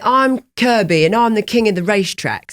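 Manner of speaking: put-on a lightly done English accent; mock English accent. Host trying out an English accent on guest. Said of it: "English accent sounds like".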